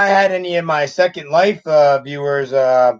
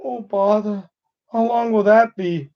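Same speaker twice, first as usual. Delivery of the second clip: Winnie the Pooh; 4 semitones higher than usual. Shown as a demonstration. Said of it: "I can do Winnie the Pooh".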